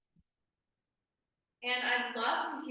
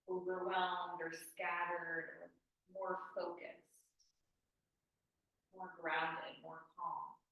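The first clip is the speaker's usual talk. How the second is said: guided meditation; 2 semitones lower, 3 dB quieter than usual. Acted. Guides a calm check-in.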